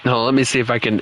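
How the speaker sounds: ordinary talk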